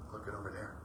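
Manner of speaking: very soft, low murmur; mumbled. Retold or imitated. Brother answering without moving, very soft murmur. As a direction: barely say it, not moving. Brother too still to move.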